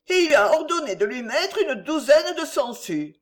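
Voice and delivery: high, insistent pleading; undaunted beggar woman. A narrator's reading.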